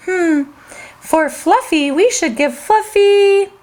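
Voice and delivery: plain reading voice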